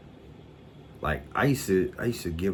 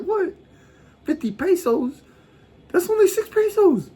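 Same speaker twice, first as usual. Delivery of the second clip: squeaky, much higher and louder indignation; mock outrage; 9 dB louder than usual. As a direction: screech the price back in disbelief. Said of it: "I got mad".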